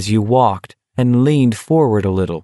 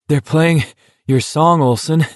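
panting between the words; out of breath; 7 semitones higher than usual. A narrator's reading. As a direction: gasp it out mid-climb. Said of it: "McVries said between pants".

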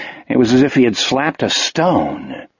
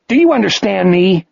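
angry demand; 6 semitones higher than usual. A narrator's reading. Jack demanding of Wolf.

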